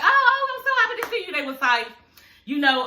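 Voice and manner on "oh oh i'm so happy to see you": high, fast mock gushing; hammed-up high fake delight; fake happy Peggy. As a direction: gush it up high like a fake friend. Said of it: "She was like".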